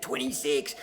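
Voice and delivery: retold call from a cell; yelled out. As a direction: call it out as a joke-teller in prison would. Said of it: "Someone yells out".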